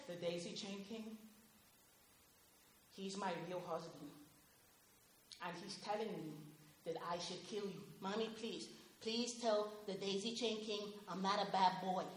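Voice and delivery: low, very soft voice; hushed, eerie murmur. Retold or imitated. A disturbed mother murmurs to her son, low and very soft. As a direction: murmur it like a secret spell. Deranged mother whispers, knife in hand.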